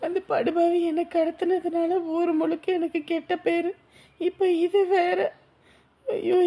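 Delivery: weep out the fear of shame; sobbing despair. Acted. Girl crying over lost honour. (காதலனிடம் அவமானத்தை நினைத்து அழுது புலம்புதல்)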